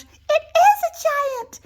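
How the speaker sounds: very high, loud, slow panicked cry; terrified. Acted. Little wolf realises it's a giant, terrified cry. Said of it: "shrieked the little wolf".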